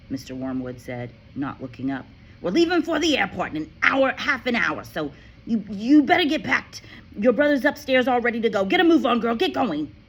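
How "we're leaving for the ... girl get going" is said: loud, impatient father's voice; bossy father. A narrator's reading. Father orders Matilda to pack, loud and impatient. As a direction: hurry the girl along.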